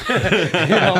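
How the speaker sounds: bursts out laughing. Real, not acted. Laughing at his own story to the listener. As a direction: cracks up at his own line.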